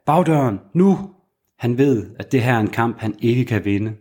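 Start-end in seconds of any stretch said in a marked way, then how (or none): 0.0-1.0 raised, higher urgent call across the room; shouting. Acted. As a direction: yell the warning to get her out. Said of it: "mens han råber".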